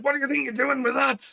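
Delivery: high-pitched, indignant shouted telling-off; shouting Zorro. Acted. Imitating Zorro shouting at Luffy in the anime, angry shout. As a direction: act out an anime outburst, pitch right up. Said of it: "he'll start shouting at Luffy".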